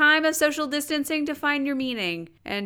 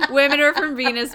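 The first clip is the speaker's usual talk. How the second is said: louder, with the joke building; louder giddy repeat; 3 semitones higher, 6 dB louder than usual. Real, not acted. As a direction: punch it out louder as the joke lands. Repeating the joke more loudly.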